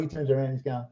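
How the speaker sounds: ordinary delivery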